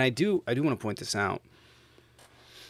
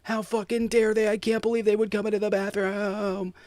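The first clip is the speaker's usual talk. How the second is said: mocking outrage; 12 semitones higher, 7 dB louder than usual. Mocking people online, mock-horrified high voice. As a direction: parody a scandalized TikTok commenter, hammed up and high. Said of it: "people are like".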